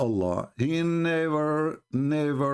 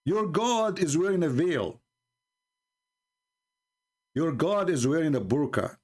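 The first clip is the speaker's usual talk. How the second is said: usual speaking voice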